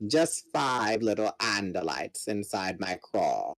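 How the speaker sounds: low, slow, menacing alien commander; gloating villain. Acted. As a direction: savour every word like a predator. Voices the villain Visser three.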